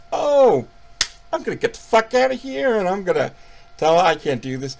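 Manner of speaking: high, loud mock-scared getaway; a hammed-up freaked-out counselor; mock panic. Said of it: "We're like".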